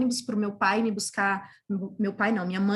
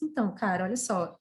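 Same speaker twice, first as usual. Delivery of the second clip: ordinary talk, nothing marked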